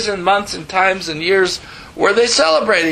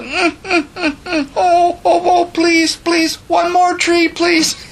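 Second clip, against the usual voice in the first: high, whimpering begging voice, slow on the last plea; tearful pleading wife; 13 semitones higher, slower than usual. Retold or imitated. Wife begging husband to keep the Christmas tree, high tearful whimper. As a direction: sob and plead like a wife losing her tree. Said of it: "And she said".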